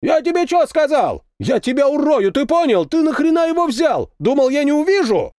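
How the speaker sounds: very high-pitched furious tirade; angry yelling. A narrator's reading. Captain chews out tankman in the tank, angry yelling. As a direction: tear into a subordinate at the top of the voice. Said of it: "заорал он".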